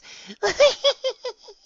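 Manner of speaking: put-on high, loud child's laughing squeal; gleeful squeal. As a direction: let out a happy shrieking giggle. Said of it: "I yell happily".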